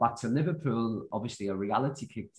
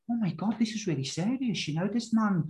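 high-pitched, mildly awed inner voice; retold amazement; 7 semitones higher than usual. Young dishwasher realising the boss values him, mildly amazed retelling.

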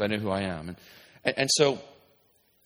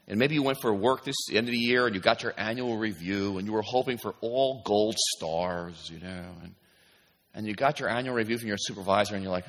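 usual speaking voice

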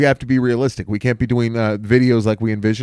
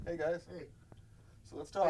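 quiet; 4 semitones higher, 25 dB quieter than usual. Pretend.

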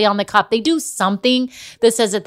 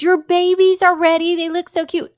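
high-pitched, sweet gushing over pets; sing-song, caring phone message; 9 semitones higher than usual. Retold or imitated. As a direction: coo it like a doting groomer. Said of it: "Acted like she cared".

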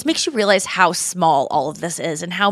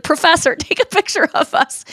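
higher, teasing mock-formal address; mock polite request; 7 semitones higher than usual. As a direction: sarcastically play along with her. Mocking bossy friend as professor.

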